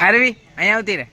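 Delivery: loud, high call across the garden. Real, not acted. Uncle calling little Aarvi to come over, loud high call.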